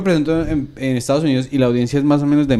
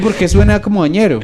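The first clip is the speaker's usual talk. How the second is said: mocking sister; 5 semitones higher, 3 dB louder than usual. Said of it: "Me dijo".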